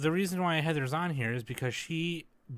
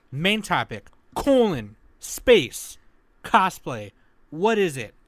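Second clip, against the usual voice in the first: lightly hammed-up louder host announcement; host announcing the topic, a touch louder; announcer read; 3 semitones higher, 8 dB louder than usual.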